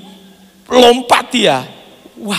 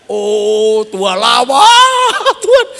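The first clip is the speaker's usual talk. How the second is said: slow, louder and higher, rising on "Tuhan"; confident prayer; 5 semitones higher, 7 dB louder, much slower than usual. Retold or imitated. Preacher redoes the prayer with conviction, loud and slow. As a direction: proclaim it like you truly believe. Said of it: "Supaya ya yakin".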